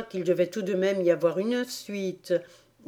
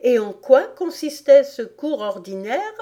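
put-on higher-pitched questioning little girl; Alice curieuse; 5 semitones higher, 5 dB louder than usual. A narrator's reading. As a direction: ask it as curious Alice. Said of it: "demanda Alice". (petite fille curieuse, voix aiguë)

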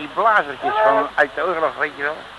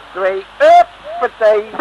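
heave-ho cheer; 9 semitones higher, 8 dB louder, much slower than usual. Acted. Heaving girl aboard.

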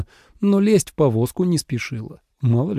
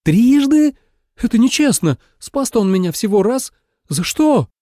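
indignant protest; 9 semitones higher than usual. A narrator's reading. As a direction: protest the injustice hotly. Snake protests unfair penance.

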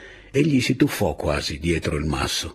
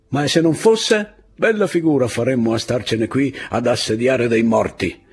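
loud, raised-pitch objection; arguing officer; 5 semitones higher, 5 dB louder than usual. A narrator's reading. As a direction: argue back high and forcefully. First officer argues back.

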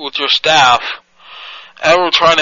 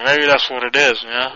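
usual speaking voice

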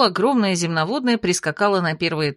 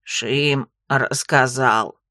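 low, slow croaking toad; gurgling frog voice; 7 semitones lower, much slower than usual. A narrator's reading. Frog character voice.

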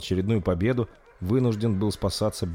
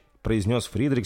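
plain reading voice

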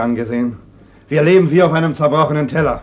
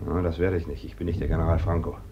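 lower, softer bitter reply; low, hushed scorn; low and quiet; 10 semitones lower, 9 dB quieter than usual. Acted. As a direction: say it quietly, with contempt. Brother refuses to use force.

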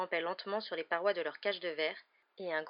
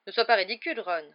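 tense rebuke; 9 dB louder than usual. A narrator's reading. Father to son joking about a reward, tense rebuke. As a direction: rebuke sharply, under strain. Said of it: "qui paraissait très tendu".

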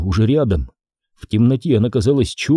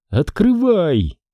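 high, slow, drawn-out urging; Jenny begging Audrey to unlock the car; urgent command; 8 semitones higher, much slower than usual. Acted. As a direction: plead for the door to open. Panicked urging to open car.

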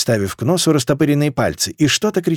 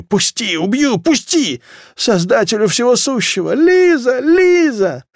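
high-pitched desperate calling; frantic cries; 10 semitones higher, slower than usual. A narrator's reading. People in a deadly crush calling out, frantic. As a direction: build to a high panicked scream.

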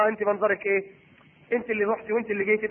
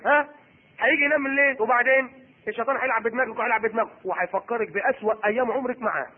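normal talking voice

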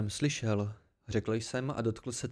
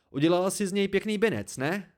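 high, quick taunting question; angry jab; 7 semitones higher, 4 dB louder than usual. A narrator's reading. Narrator taunts Dena about her life, angry jab.

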